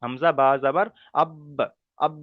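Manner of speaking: হামজা বা যাবার আব slow with the final ব bounced; qalqala on বা. Shown as a demonstration. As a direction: jolt the ব of আব slowly. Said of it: "কেমন করে পড়তে হবে".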